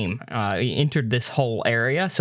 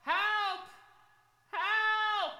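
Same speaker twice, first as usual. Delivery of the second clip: faint, high, far-off cries of "Help!"; distant cries for help; 10 dB quieter, much slower than usual. Acted. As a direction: call for help from far away, high and thin. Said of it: "you hear in the distance".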